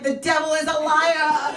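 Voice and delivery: high, loud, drawn-out denouncing; mock rebuke. Comedian retelling how she rebuked her husband, mock rebuke. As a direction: rebuke like a hammed-up preacher, slowly. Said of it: "I was rebuking him".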